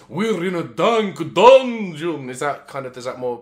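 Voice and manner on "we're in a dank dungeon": spooky vampire voice. Pretend. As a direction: set a creepy mood, drawn out. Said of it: "let me set the mood".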